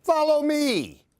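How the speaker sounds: put-on a fearless boy urging his friends on; bold invitation. A narrator's reading. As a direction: lead the way brightly. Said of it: "but I just tell them".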